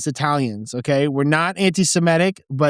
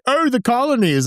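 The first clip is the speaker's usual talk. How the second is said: lofty mock British accent, pitched higher; 9 semitones higher than usual. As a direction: put on a snooty British accent. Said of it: "some fucking British person is like".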